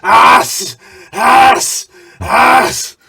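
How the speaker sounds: loud, high wrestler yelling; shouted grunts. Retold or imitated. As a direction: bark it out like a wrestler mid-match. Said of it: "he hears this motherfucker".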